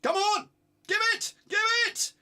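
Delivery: high, loud shout to pass; frustrated fan yelling; yelling at the screen. Real, not acted. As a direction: scream for the ball to be given. Yelling at player during match.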